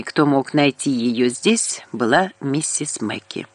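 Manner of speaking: ordinary delivery, nothing marked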